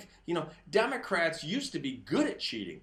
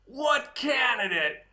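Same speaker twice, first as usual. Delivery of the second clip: retold yell; 8 semitones higher, 7 dB louder, slower than usual. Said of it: "the guy yells down".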